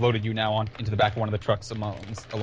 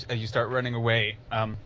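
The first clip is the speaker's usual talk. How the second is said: ordinary delivery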